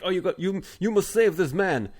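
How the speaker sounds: high, loud mock Russian plea; terrible Russian accent. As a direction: plead urgently in a bad Russian accent. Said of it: "That's my terrible Russian accent".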